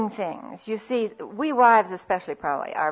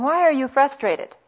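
pointed question; 6 semitones higher, 4 dB louder than usual. Shown as a demonstration. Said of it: "Instead of saying".

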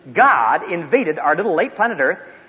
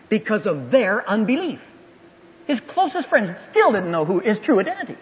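ordinary talk, nothing marked

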